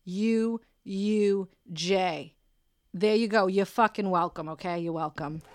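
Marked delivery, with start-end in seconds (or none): 0.0-2.3 each letter said slowly and apart; slow spelling. Shown as a demonstration. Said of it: "she spelled it".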